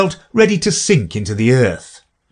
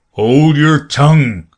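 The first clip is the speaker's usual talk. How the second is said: louder, slow gruff creature command; Gryphon snapping; 5 semitones higher, 5 dB louder, slower than usual. A narrator's reading. Gryphon silencing Alice, gruff and louder. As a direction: bark it down at Alice. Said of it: "added the Gryphon".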